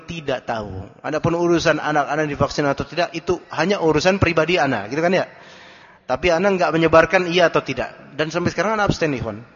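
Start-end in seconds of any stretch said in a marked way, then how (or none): none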